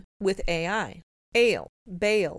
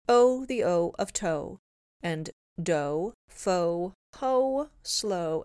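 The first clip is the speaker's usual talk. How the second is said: normal talking voice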